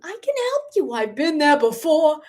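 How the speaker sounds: high, breathy Boston accent as Paulette; Boston accent sung-out high and airy; Paulette's Boston accent. Shown as a demonstration. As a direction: go up high and push air through the Boston vowels. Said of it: "I love that accent".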